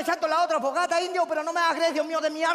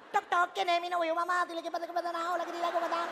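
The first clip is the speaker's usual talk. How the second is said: wrong wooing voice; 2 semitones higher, 7 dB quieter, faster than usual. Shown as a demonstration. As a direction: rattle it off in a tiny monotone. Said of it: "porque así no me iba a dar bola".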